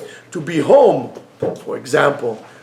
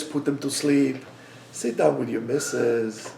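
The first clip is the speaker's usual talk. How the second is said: lower, softer, unhurried; calm and soft; 4 semitones lower, 8 dB quieter than usual. Real, not acted. Preacher softly picturing an evening with his wife, lower and calm. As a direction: settle in by the fire, softly.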